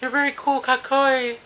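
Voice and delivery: mock her in a high giggly voice; girly voice. The Japanese girl agreeing they're a good team, in a high squeaky voice. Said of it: "She's like".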